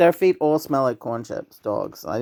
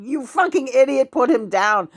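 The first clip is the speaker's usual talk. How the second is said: mock yell; 12 semitones higher, 3 dB louder than usual. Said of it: "And I'm going".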